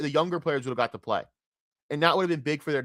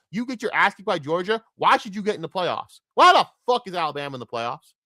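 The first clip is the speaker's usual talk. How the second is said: usual speaking voice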